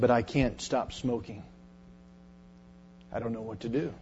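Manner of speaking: a low, defeated murmur; hushed confession. Retold or imitated. Young man confesses smoking habit.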